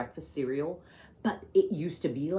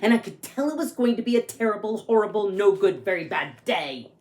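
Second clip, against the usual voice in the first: lively read-aloud storytelling; story narration; 5 semitones higher, 6 dB louder than usual. A narrator's reading. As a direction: read it like a picture book to kids. Reads picture book aloud.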